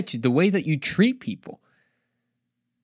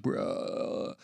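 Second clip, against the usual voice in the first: whispered, much softer exasperated bruh; annoyed bruh; 7 semitones lower, 12 dB quieter than usual. Retold or imitated. Cook answering a hungry relative with a whispered, exasperated bruh. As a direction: breathe out Bruh like you can't believe it. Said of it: "And you're like".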